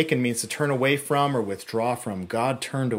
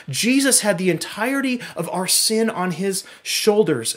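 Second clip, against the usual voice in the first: louder, driving sermon delivery; preaching at full force; 8 semitones higher, 6 dB louder than usual. Acted. Pastor preaching to the church, fervent and loud. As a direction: preach it hard to the congregation.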